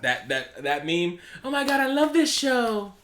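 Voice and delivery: high-pitched overexcited gushing; mock gushing fan. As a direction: ham up a giddy superfan. Over-eager fan persona.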